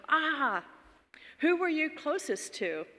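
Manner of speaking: probing friend. Retold or imitated. Friend probes which parent shaped the accent, intrigued. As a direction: voice the linguist friend onto a clue.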